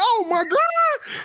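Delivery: slow, high, drawn-out "oh my God"; amazed. Retold or imitated. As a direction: gasp it out in delight. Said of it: "I was like".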